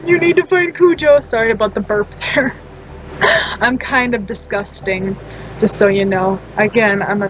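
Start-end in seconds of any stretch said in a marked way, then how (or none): 0.1-1.2 higher-pitched, insistent demand; reenacted frantic insistence; urgent plea. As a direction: plead urgently, repeating it for emphasis. Acted urgent plea to brother.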